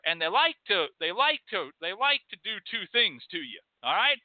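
normal talking voice

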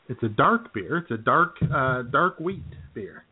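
usual speaking voice

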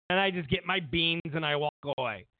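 ordinary talk

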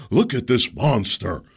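loud, slow mock outrage; hammed-up indignant scold; mocking accusers. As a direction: parody the outraged commentators. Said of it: "video of me yelling".

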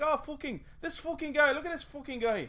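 high-pitched Irish brogue swearing; Irish accent. Acted. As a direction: cuss casually in a lilting Irish accent. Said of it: "get away with it by being Irish".

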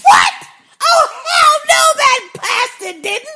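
very high, incredulous retold outburst; mad, yelling at TV. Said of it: "I was so mad. I said to the T V".

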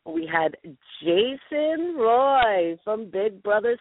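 drawn-out, high-pitched guest reveal; showy announcement. Pretend. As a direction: stretch the name like a proud announcer. Grandly announcing celebrity party guests.

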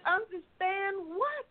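high, slow retold question; acted-out baffled question, rising at the end; bewildered questioning. Daughter questions mother's puzzling remark.

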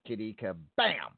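"Kabam" with the final BAM punched out; stress on last syllable. Shown as a demonstration. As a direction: hit the end of "Kabam" hard after a tiny hold. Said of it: "you gotta say it right".

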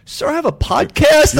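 high, drawn-out mock protest; loud, high and stretched, playing up his cluelessness; incredulous retort. Guy retelling his reply to a friend, incredulous.

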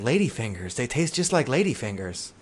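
low, soft, lost castaway voice; a hushed, grim mock castaway; quoting the stranded guy. Podcast host quoting a starving castaway, low and hushed. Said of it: "then he's just like".

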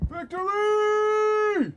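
long high yell, stretched slowly; drawn-out shout. Retold or imitated. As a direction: belt it out, stretched. Ari Gold catchphrase yelled.

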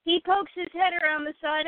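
ordinary talk, nothing marked

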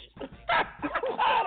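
high squealing laugh. Real, not acted. Laughs at Kim.

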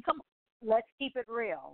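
normal talking voice

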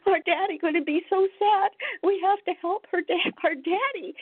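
high, tearful child's plea imitated; crying child. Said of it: "the little girl was crying".

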